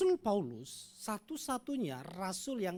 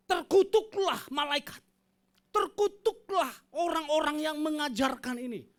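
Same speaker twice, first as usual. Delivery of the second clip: loud, higher furious curse; Paul cursing; 7 semitones higher, 7 dB louder than usual. Acted. Preacher voicing Paul cursing false teachers, loud and furious. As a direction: pronounce the curse like an enraged apostle.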